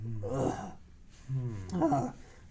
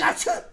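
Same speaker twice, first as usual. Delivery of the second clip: loud high sneeze; big sneeze; 5 dB louder than usual. Pretend. Dad pretends to keep sneezing.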